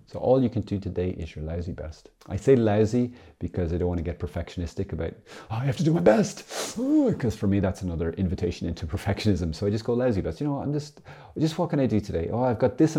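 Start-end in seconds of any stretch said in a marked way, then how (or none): 5.5-7.1 mock perfectionist. Mocking perfectionist self.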